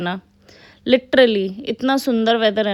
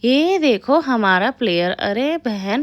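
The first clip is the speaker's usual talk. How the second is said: usual speaking voice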